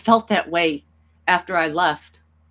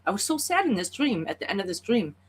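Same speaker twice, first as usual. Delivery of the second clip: near tears; 3 semitones higher than usual. Real, not acted. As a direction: voice rising high as tears come, real sorrow. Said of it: "Try not to cry".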